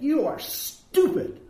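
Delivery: high, slow, scornful put-down; contemptuous. Shown as a demonstration. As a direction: draw it out with open contempt. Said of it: "when you say it like that".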